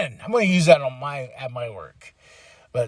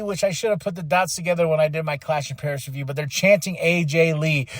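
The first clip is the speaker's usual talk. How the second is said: ordinary talk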